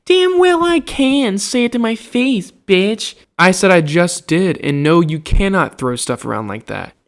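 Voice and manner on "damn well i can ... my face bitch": imitating a rival girl's defiant snap; mocking a catty girl. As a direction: mimic her like a snotty mean girl. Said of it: "And she's like".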